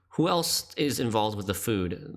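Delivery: ordinary delivery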